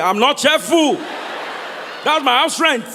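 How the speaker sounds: shouting. Retold or imitated. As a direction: shout it out, outraged. Comedian shouting about offering.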